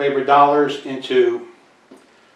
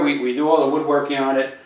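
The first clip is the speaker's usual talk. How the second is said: normal talking voice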